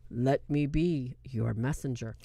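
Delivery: very low, slow priest voice; deep, drawn-out imitation of a movie priest; mock solemn priest. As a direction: drop deep and intone it slowly. Mocking the movie priest's line.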